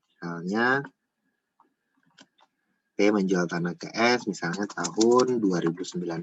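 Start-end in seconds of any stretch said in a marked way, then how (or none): none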